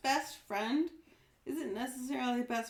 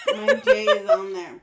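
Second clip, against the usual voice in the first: laughing; 13 dB louder than usual. Real, not acted. Sudden laugh at own mistake.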